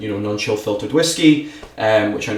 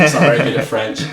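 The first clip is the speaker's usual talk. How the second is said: laughing; 4 semitones higher, 4 dB louder, faster than usual. Real, not acted. Joking about his own accent.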